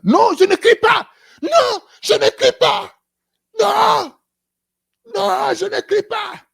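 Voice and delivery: hammed-up angry denial, shouted high; indignant shout. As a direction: shout back that you are not shouting. Acted quarrel, denying shouting.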